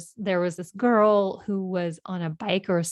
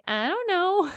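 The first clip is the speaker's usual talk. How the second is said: high-pitched imitation of a child; little boy; 12 semitones higher than usual. Son answers his frantic mother, imitated little-kid voice. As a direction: answer in a small kid's high voice.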